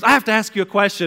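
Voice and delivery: high, loud and urgent; imitating a mom who won't be put off; insistent mom. Mom presses son in the shower, insistent.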